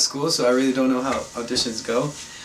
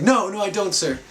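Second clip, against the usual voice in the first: outward agreement; 7 semitones higher than usual. Retold or imitated. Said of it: "but outside I was like".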